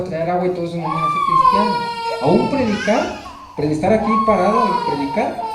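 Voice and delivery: near tears, voice cracking high; voice breaking. Real, not acted. Preacher telling the congregation, voice breaking with tears. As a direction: push through the words as they choke up. Preacher overcome while declaring his faith.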